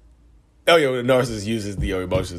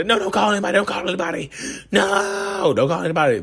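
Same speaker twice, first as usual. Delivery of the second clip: much higher, urgent panicked begging; panicked pleading; 10 semitones higher than usual. Retold or imitated. Partner begging you not to call anyone, high panicked plea. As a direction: beg them frantically not to call.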